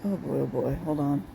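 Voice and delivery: low dopey lunkhead voice. Pretend. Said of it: "I'm putting my lunkhead voice on".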